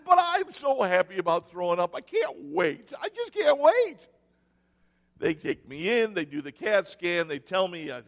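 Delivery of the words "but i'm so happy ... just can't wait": sarcastic mock eagerness. Mock joy about throwing up.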